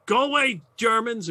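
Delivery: put-on high, loud, hostile accented English; broken English. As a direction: shout it in halting foreign English. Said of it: "in broken English".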